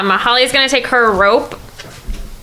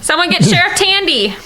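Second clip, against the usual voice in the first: calling out; 8 semitones higher than usual. Acted. Calling out to the crowd.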